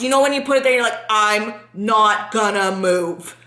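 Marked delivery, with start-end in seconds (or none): 1.1-3.4 very slow, word by word; acting paralyzed. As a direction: speak as if frozen stiff, not daring to move. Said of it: "I felt paralyzed".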